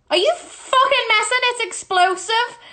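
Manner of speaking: high, loud outraged outburst; loud, exasperated shriek; incredulous yell. Real, not acted. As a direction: yell it in total disbelief. Outraged at the answer.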